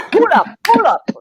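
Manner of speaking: high playful hype call; mock DJ hype. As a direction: shout it like a club DJ, laughing. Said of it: "I'm like".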